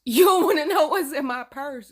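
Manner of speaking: loud, high mock warning; mock threat. Warning the joker about the purse, loud mock threat.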